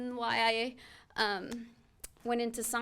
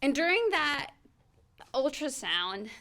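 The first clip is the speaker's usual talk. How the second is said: normal talking voice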